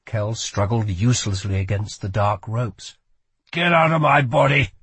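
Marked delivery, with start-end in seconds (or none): 3.5-4.7 louder, higher growled demand; angry growl. A narrator's reading. Kel to the one possessing him, growling. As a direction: growl it out forcefully at an enemy. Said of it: "he growled".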